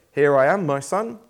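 put-on warm, lifted reply to the boy; cheery. Abraham answering his son Isaac, lightly cheery. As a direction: answer your son brightly. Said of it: "probably in a fairly cheery voice".

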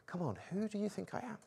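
soft, quick coaxing question; hushed, earnest imitation; sincere appeal. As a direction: ask it quietly, urging an honest answer. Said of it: "the exact same words, being a totally".

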